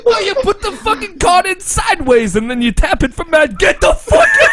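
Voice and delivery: high mock voice building to a shriek; explain, then explode into a very high loud yell; hammed-up dub character. Friend parodying a card player explaining rules, shrieking at the end.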